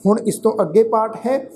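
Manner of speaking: usual speaking voice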